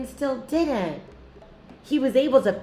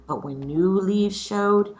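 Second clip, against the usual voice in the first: lively read-aloud storytelling; story reading; 5 semitones lower, 7 dB louder than usual. A narrator's reading. Teacher reading a picture book aloud to her scholars, lively.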